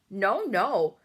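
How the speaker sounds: loud frightened denial; pleading cry. A narrator's reading. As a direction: cry it out to deny. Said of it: "cried the B F G".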